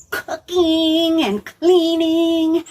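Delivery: slow sing-song list; drawn out. Acted. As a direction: stretch each chore word out. Narrating Martha's busy work.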